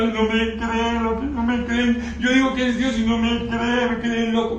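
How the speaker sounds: mocking a weeping prophet who feels unbelieved; mock whining. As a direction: whimper it like a self-pitying complainer. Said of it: "hay gente que comienza a llorar".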